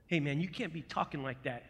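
ordinary talk, nothing marked

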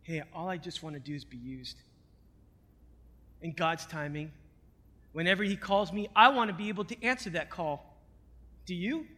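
soft low sermon hush. Real, not acted. Quiet intimate preaching aside.

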